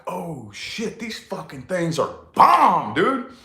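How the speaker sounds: slow, drawn-out wow these are bomb; amazed discovery. Retold or imitated. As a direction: savor the surprise of the first bite. Kid discovering anchovies taste great.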